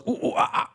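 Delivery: breathy mock monkey hoots; monkey noises. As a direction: imitate a chattering monkey, softly. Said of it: "I'm the monkey who goes".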